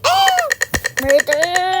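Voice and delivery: loud high scream into a long yell; shouting. Real, not acted. Player yelling on seeing the murderer role, shouting. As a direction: scream out the role you just got. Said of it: "Sumisigaw ako".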